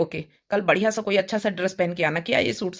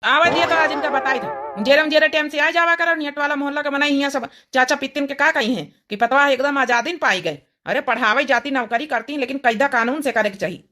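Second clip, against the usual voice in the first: scolding village husband; 6 semitones higher than usual. Acted. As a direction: berate the late wife in dialect. Husband scolds wife late home.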